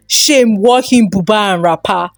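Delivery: ordinary delivery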